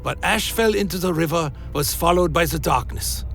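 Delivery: plain reading voice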